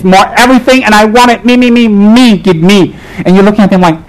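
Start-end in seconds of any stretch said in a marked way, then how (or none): 0.0-3.0 higher, mock greedy frenzy; greedy clamour. Mimicking a devotee who wants more deities, greedy clamour. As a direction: mimic a grabby devotee begging, pitch held high.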